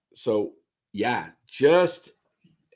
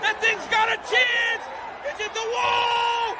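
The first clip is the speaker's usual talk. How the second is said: shouting, much higher, drawn out; home run call; slower than usual. Shown as a demonstration. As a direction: roar it like the ball is gone. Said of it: "Here's my attempt at calling a game".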